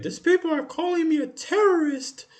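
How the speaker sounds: high, loud whiny mock wail; mock crying yell. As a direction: ham up a man yelling he's called a terrorist. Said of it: "he started crying and yelling".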